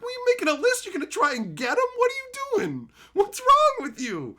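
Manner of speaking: very high-pitched, exasperated outburst; incredulous scolding. Retold or imitated. Confronting a friend over his list of names, incredulous scolding.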